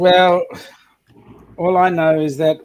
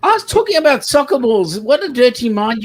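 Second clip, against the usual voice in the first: high, loud mock protest; mock indignant; 8 semitones higher, 4 dB louder than usual. Real, not acted. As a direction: play offended innocence.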